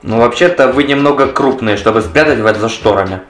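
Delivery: usual speaking voice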